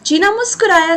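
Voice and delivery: ordinary delivery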